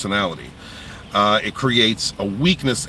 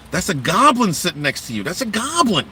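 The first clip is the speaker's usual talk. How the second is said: friends warning; 6 semitones higher than usual. Retold or imitated. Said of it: "everybody's te".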